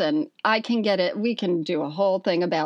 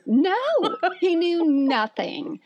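laughing insistence; 9 semitones higher than usual. Real, not acted.

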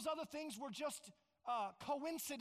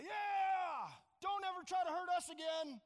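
loud, higher triumphant yell; defiant high-handed shout; 7 semitones higher, 6 dB louder than usual. Retold or imitated. Israelites shouting back at Egypt as they march out, defiant yell. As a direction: shout it as free people leaving their captors. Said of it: "they go out with a high hand".

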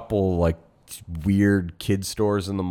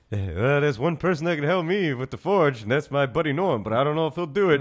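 higher, rushed at the end; high character voice; 6 semitones higher than usual. Retold or imitated. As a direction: pitch it up, hurry the last bit. Voicing character asking for help.

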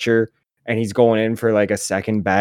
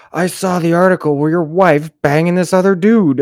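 higher-pitched mock taunt; mock rude heckle; 6 semitones higher, 4 dB louder than usual. Heckler showing the rude taunt he won't use, mock jeer.

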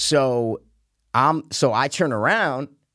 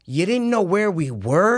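higher, drawn-out mock disbelief; incredulous retort; 5 semitones higher, slower than usual. Golfer challenging the kid's excuse, incredulous. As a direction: throw the excuse back, slowly.

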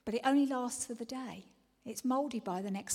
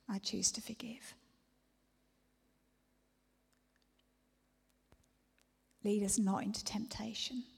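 whispered prayer. Acted. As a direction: pray it under your breath.

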